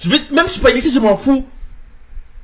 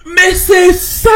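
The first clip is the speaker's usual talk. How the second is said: excited shout; 9 semitones higher, 3 dB louder, much slower than usual. Real, not acted. Cri d'approbation.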